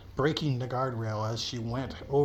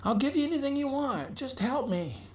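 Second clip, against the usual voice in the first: much higher, frantic begging; panicked pleading; 14 semitones higher than usual. Acted. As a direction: plead for your life, voice up high. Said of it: "She's like".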